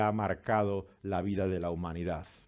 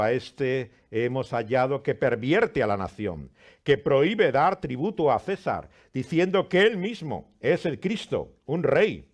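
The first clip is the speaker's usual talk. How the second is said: accusing crowd; 4 semitones higher, 7 dB louder than usual. A narrator's reading. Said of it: "Y comenzaron a acusarle".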